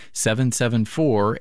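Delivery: ordinary talk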